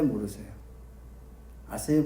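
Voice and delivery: ordinary delivery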